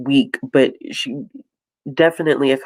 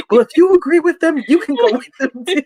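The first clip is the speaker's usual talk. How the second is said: higher, fast mock retort; mock snippy retort; 13 semitones higher, 4 dB louder, faster than usual. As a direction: toss it off like a snippy cat-owner.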